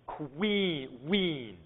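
queen then ween, softly stressing the "wee" glide; isolating the sound. Shown as a demonstration. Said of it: "this part here".

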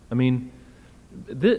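normal talking voice

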